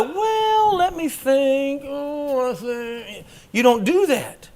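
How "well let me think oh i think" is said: high, slow, hesitant pondering; mock dithering. Preacher mocks stalling before the king, high and hesitant. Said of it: "you don't go".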